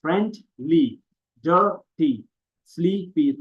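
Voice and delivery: friendly, dirty, sleepy each split into two syllables. Shown as a demonstration. Teacher showing two syllables to students, friendly, dirty, sleepy each split. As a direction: break them up as friend-ly, dir-ty, slee-py. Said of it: "सब में दो syllables हैं".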